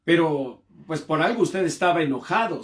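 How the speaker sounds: a hint of a doubting listener talking back; imagined listener objecting. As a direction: voice the skeptical audience. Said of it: "Yo sé que ustedes están pensando".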